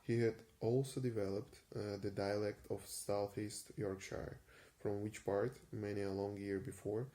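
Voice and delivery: ordinary delivery, nothing marked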